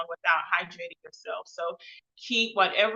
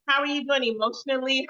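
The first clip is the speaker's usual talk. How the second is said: ordinary talk, nothing marked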